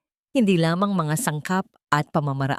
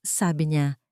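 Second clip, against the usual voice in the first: ordinary delivery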